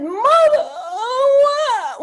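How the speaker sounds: a hammed-up young Southern accent, near tears. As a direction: whine "Mother" like a wounded Southern daughter. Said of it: "when I had a Southern accent".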